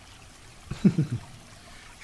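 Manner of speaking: a long, high, airless chuckle; breathy teasing laugh. Acted. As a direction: laugh under the breath at them. Partner caught not noticing hickey.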